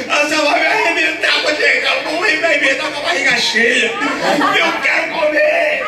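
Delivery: loud, high, gleeful pleading; excited preaching. Acted. Preacher tells congregation he wanted all the fruit, loud and gleeful. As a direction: shout it like a kid let loose.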